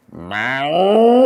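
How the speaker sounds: a loud rising animal call through cupped hands. Shown as a demonstration. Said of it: "Sometimes I hold my hands, I go".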